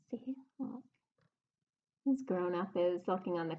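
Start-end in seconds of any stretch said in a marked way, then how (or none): 0.1-0.8 whisper. Pretend. Secret aside to kids.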